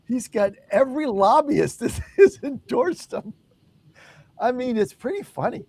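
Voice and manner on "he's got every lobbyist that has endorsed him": laughing. Real, not acted. Laughing while telling.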